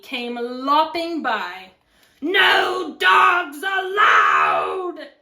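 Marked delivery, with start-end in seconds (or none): 2.2-5.1 very slow, drawn-out loud shout; angry yell. Acted. Cactus to passing coyote, yelling. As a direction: bellow it word by word. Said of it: "Hank yelled".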